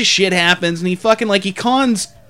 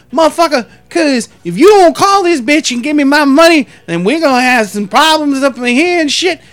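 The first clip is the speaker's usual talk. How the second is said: higher, louder threatening impression; imitated drunk man; 4 semitones higher, 4 dB louder than usual. Drunk man threatening trouble over his money, higher loud impression. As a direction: mimic a drunk man's blustering threat. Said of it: "he kept talking like that dude".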